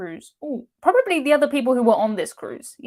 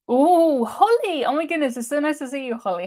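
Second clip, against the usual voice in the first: delighted high-pitched greeting, starting loud; starstruck surprise; 5 semitones higher, 3 dB louder than usual. Real, not acted. Spotting a famous viewer in the chat, starstruck surprise. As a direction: gasp out a thrilled, higher-pitched welcome. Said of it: "I'm feeling a little bit starstruck".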